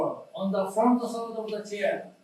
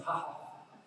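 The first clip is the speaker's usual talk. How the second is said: unvoiced, much softer ha ha; breathy laugh; 13 dB quieter, much faster than usual. Shown as a demonstration. Teacher showing the quiet laughing breath, soft breathy laugh. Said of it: "When we are laughing".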